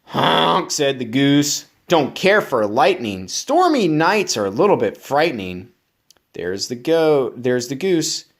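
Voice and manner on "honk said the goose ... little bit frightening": lively, bouncy storybook reading; reading to children. Acted. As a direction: read it up to keep a child listening. Reads picture book aloud.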